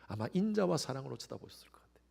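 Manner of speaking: soft whispered tenderness; tender whisper. Real, not acted. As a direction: whisper it lovingly. Tender gaze described softly. (다정하게 속삭이듯)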